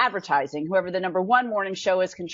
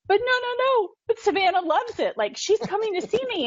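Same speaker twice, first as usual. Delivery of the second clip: much higher, urgent pleading; pleading protest; 10 semitones higher than usual. Retold or imitated. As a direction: beg them to see it your way. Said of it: "but I'm like".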